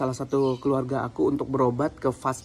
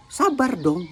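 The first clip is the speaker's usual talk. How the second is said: snappy, higher-pitched mock retort; scatto a voce alta imitato; 8 semitones higher, slower than usual. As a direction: imitate her curt, high-toned telling-off. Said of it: "Dia ngegas nada tinggi".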